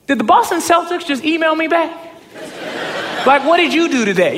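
high-pitched, incredulous outburst; excited disbelief. Retold or imitated. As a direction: blurt it out, amazed they replied. Excited disbelief at getting a reply.

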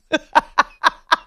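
a real loud laugh breaking out; loud burst of laughter. Real, not acted. Host laughing at the Zion bloke tweet, loud laugh. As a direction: laugh out loud, high and free.

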